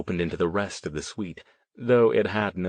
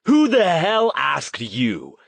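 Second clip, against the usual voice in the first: furious tirade; 6 semitones higher, 3 dB louder than usual. A narrator's reading. Riley red-faced, turning on Stone, furious tirade. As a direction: turn on him and threaten angrily.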